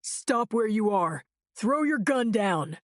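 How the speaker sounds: slow, firm commanding yell. A narrator's reading. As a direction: order him to stop.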